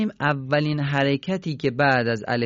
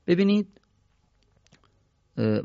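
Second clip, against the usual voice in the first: ordinary talk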